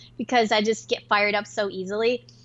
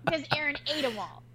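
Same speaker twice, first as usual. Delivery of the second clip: normal talking voice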